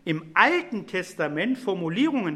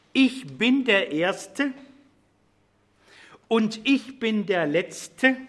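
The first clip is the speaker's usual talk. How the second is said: slow solemn reading; 3 semitones higher, much slower than usual. Shown as a demonstration. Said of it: "da scheint die Betonung der Aussage".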